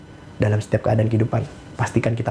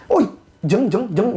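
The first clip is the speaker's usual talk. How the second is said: high-pitched mock housewife chatter; gossiping housewife; 9 semitones higher, much slower than usual. Housewife calling a friend at an arisan, gossipy. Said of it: "ibu-ibu coba kalau lagi ngumpul arisan".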